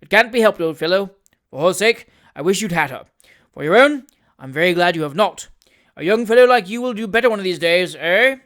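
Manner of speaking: hearty and consoling, a bit loud; hearty old gentleman. A narrator's reading. Wardle consoling Tupman heartily.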